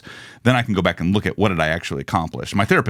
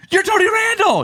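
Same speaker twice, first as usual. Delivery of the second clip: much higher, starstruck gasp of recognition; starstruck exclaim; 5 dB louder than usual. Retold or imitated. Forgetful contestant greeting Tony Randall, high starstruck exclaim. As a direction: gush like a fan spotting a star. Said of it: "He goes".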